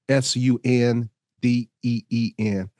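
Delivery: ordinary talk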